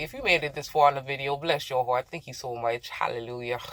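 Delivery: grateful thanks. Real, not acted. Thanking viewers.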